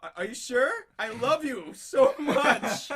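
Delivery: mock tender plea. Boyfriend insists on launching the dog, slow and gushing. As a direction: gush it over the top. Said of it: "I'm like".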